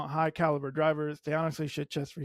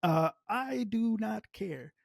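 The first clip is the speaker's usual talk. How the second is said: ordinary delivery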